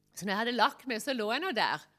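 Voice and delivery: usual speaking voice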